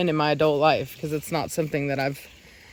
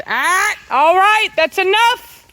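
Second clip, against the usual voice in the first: high loud shout; yelling at dogs; 13 semitones higher, 8 dB louder, slower than usual. Real, not acted. Owner shouting at fighting dogs to stop, high and loud.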